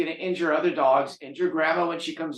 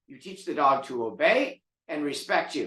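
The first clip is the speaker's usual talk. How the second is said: usual speaking voice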